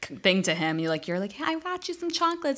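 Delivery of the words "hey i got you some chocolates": high, eager and sweet; sweet girly voice. Retold or imitated. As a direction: chirp it like an excited girl. Girl voiced giving chocolates.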